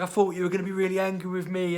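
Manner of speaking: high, loud put-on kid voice; mimicking worried son. Son's worried words mimicked.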